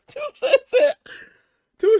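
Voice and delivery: laughing hard, voice high and loud. Real, not acted. Streamer trying to retell Two Cent's joke, laughing hard. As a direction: laugh your way into the words.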